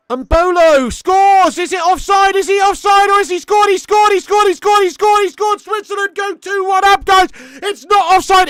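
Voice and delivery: loud high screaming goal call; hammed-up commentator scream; goal celebration shout. Reacting to a goal.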